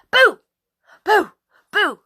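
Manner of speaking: put-on delighted baby yelling boo; peekaboo boos. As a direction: pop out with each boo. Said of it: "says Jackie".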